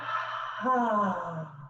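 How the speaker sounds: long audible sigh, falling; breathing out. Shown as a demonstration. Leader guiding deep breaths, relaxed audible exhale. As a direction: let the breath go on a soft "ah". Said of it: "And breathe out".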